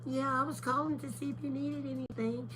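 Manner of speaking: put-on high, soft sweet-old-mom voice; imitating my mama. Shown as a demonstration. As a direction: gently imitate mom calling to check in. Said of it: "talk like my mama".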